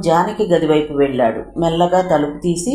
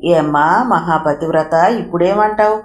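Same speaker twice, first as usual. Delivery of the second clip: usual speaking voice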